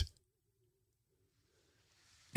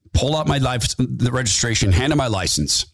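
ordinary delivery

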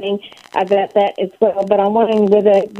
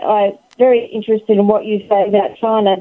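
usual speaking voice

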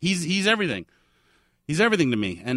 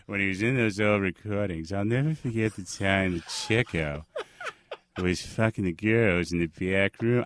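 a hint of a frail old Groucho; 6 semitones lower than usual. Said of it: "It's Groucho right before he died".

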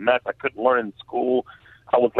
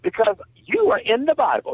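Missus Spears; 10 semitones higher than usual. Retold or imitated. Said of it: "He said".

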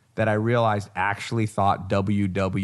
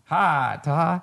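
country accent imitation; 4 semitones higher than usual. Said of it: "he used to talk like this".

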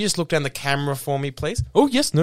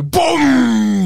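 loud, higher booming "Boom!"; explosion boom; 8 semitones higher, 5 dB louder than usual. Retold or imitated. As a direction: shout Boom like a bomb going off. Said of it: "I just had to go".

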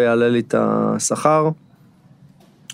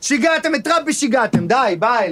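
high, shrill furious outburst; 12 semitones higher than usual. Acted. Guest storming out on the podcast hosts, high furious outburst. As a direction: storm off in a rage.

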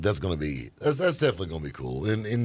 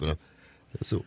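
ordinary delivery

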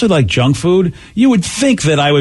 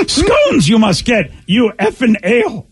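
slow, higher furious Yoda voice; angry Yoda; 7 semitones higher, slower than usual. Retold or imitated. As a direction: Yoda losing his temper over scones. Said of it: "Damn, Yoda got mad".

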